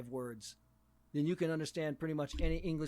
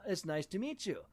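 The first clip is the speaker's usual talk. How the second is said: stilted textbook English; 3 semitones higher than usual. Retold or imitated. Pupil reciting a taught English introduction, stilted and over-careful. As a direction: recite it like a language-drill pupil. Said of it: "the way they're taught is".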